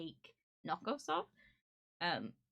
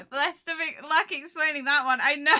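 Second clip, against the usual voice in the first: loud, high, laughing outburst; 9 semitones higher, 18 dB louder than usual. Real, not acted. Streamer joking with chat about explaining the figure, laughing. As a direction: crack up while getting the words out.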